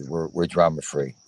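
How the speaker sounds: usual speaking voice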